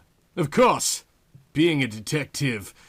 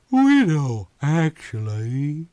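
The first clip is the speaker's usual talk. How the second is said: Yvonne's voice; 2 semitones higher, 4 dB louder, slower than usual. A narrator's reading. Yvonne asking the detective his name, Southern and slow. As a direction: play her sultry and unhurried.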